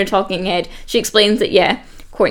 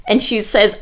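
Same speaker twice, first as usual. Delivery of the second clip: usual speaking voice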